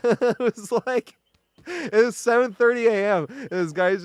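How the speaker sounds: giggling as the memory returns; laughing. Real, not acted. Laughing recalling screaming man.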